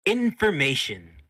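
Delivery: loud, high, drawn-out exclamation; loud outburst. Acted. Myrna to her beaten captive, loud and drawn out. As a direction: call it out big and slow. Game master voices gleeful torturer.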